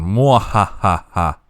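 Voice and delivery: slow, loud evil laugh; mad scientist cackle. Pretend. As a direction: cackle like a villain over power. Evil laugh over power grab.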